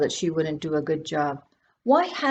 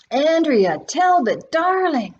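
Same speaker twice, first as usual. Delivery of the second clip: high, loud, gushing greeting; gushing scream; 9 semitones higher, 4 dB louder, slower than usual. A narrator's reading. Great-aunt greeting Andrea with a high gushing cry. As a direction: call out her name like a delighted great-aunt. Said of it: "She fairly screamed".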